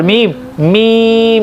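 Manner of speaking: मीम slow, lips touching lightly; soft meem demo. Shown as a demonstration. Teacher demonstrates meem to the class. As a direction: hum मीम gently on the lips. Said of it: "होंठों के तर हिस्से से".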